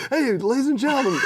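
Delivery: high-pitched mock show announcer; talking-balls announcer. Singer voicing talking balls greeting a crowd, mock announcer. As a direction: open the show in a high little voice.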